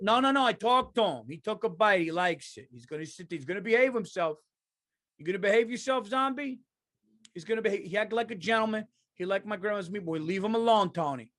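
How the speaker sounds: mock-earnest Italian defending his zombie pal; Italian mob guy. Italian telling Tony to leave the zombie alone, insistent. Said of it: "if an Italian was across the zombie".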